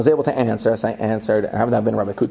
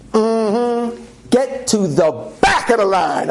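sassy dismissal; 9 semitones higher, much slower than usual. Retold or imitated. TSA lady dismissing him.

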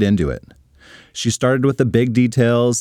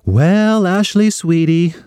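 sweet higher mom voice; 7 semitones higher, 3 dB louder, slower than usual. Acted. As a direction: coo it like a doting mom. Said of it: "said her mom".

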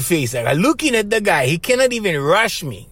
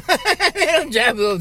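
higher, chuckling through the words; laughing; 8 semitones higher than usual. Real, not acted. Telling how he made Rory drive him home, laughing. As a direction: laugh as you repeat the punchline.

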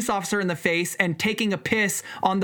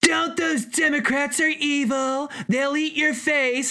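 slower, higher mocking voice of a scared partisan; mock fearmonger; 6 semitones higher, slower than usual. Mocking a partisan who warns fans about Democrats, slow and raised. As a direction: ham up the scary warning to his listeners. Mocking Trump supporters' fear talk.

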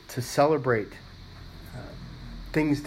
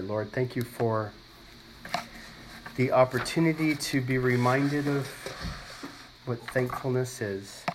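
prayer; 3 semitones lower than usual. Real, not acted. Leads prayer.

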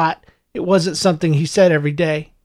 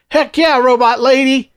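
higher, excited shout into the phone; excited yell; 7 semitones higher than usual. A narrator's reading. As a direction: yell it with glee. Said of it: "he yelled into the phone".

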